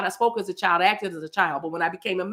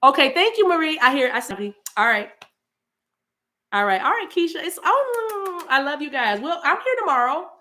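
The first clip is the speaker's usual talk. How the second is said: excited; 6 semitones higher, 3 dB louder than usual. Real, not acted. Excited sign-off shout-outs.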